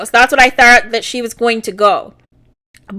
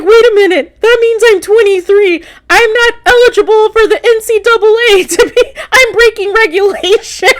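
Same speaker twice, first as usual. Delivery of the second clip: high, hammed-up panic breaking into laughter; upset athlete imitation; 8 semitones higher than usual. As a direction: wail the protest in a mock-distressed high voice. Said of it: "he's upset. He's like".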